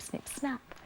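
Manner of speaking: plain reading voice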